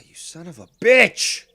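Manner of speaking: loud, high yell on the last word; frustrated curse. Real, not acted. Streamer cursing the fish that won't bite, frustrated yell. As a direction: curse it out, exploding at the end.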